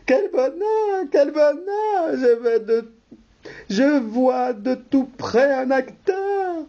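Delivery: overjoyed, voice cracking up high; jumping for joy. Acted. Wife ecstatic at seeing an actor up close, squealing. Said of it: "sautant de joie".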